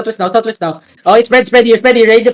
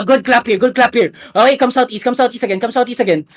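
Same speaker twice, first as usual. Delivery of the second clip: ordinary delivery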